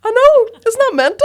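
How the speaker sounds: high and loud, giddy; bursting with disbelief; excited disbelief. Real, not acted. Laughing outrage at the refund story.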